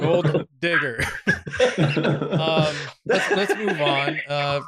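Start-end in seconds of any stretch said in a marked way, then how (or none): 0.0-1.0 "Gold digger" with the D sound held long and doubled between the words; stressed double D. Shown as a demonstration. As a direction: lean hard on both D's in "Gold digger", laughing. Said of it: "gotta make sure that those two D's are really in there".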